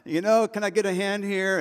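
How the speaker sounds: high, loud mock cry of people stuck on their knees, laughing; struggling kneelers. Pastor mimicking his congregation stuck kneeling, laughing mock shout. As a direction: ham up old folks who can't get up. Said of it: "half the congregation's like".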